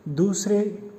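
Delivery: ordinary delivery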